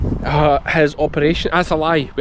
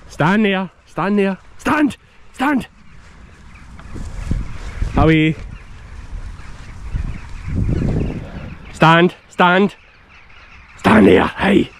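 shouted dog command; 4 semitones higher, slower than usual. Real, not acted. Commanding dog across field.

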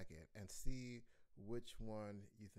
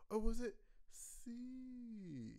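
slow high tease; 11 semitones higher, much slower than usual. Shown as a demonstration. Teacher teasing the class with the last choice, slow and high. Drawn-out quiz options.

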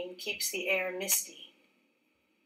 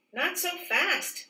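higher, slow, amused warning; with a grin; 7 semitones higher, slower than usual. Acted. Isaac teasing his new worker, smiling playful warning. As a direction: gently tease her to slow down, smiling. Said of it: "says Isaac with a grin".

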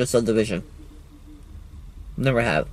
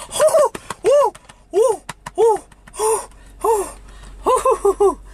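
whooping. Real, not acted. Railfan cheering over his recording, whooping.

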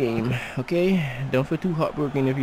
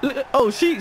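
ordinary delivery

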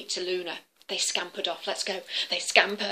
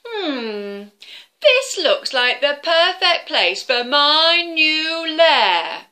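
slow, louder greedy dragon voice; 6 semitones higher, 8 dB louder, much slower than usual. Acted. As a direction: drawl it like a smug dragon. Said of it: "said the dragon".

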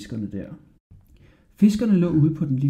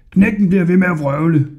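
loud, higher scornful shout; loud porter; 4 semitones higher, 7 dB louder than usual. A narrator's reading. Fat porter scorning Hodja, loud shout. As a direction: shout it to the others. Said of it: "råbte den største".

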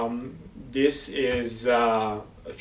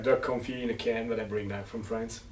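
usual speaking voice

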